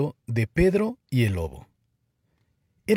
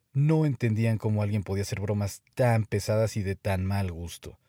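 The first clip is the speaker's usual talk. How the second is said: ordinary delivery, nothing marked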